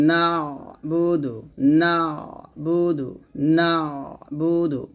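slow "না'বুদু" with the throat ain held. Shown as a demonstration. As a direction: stretch and squeeze the first syllable of "না'বুদু". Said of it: "আইন উচ্চারণটা ধরবেন".

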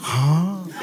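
ordinary talk

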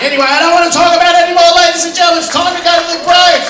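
shouted announcement. Real, not acted. Comedian shouts to audience that it's break time, fast and loud. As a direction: rev up the audience.

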